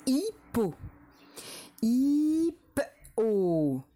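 syllable dictation. Shown as a demonstration. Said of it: "pour écrire la première syllabe".

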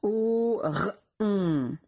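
"Au r on" as three slow, separate sounds; sounded out. Shown as a demonstration. Teacher helping pupils spell a syllable, sounded out slowly. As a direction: hold "Au", isolate the r, then hold "on". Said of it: "c'est la syllabe".

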